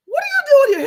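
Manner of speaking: high, loud, shrieking disbelief; shocked annoyed squeal. Retold or imitated. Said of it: "I was so annoyed".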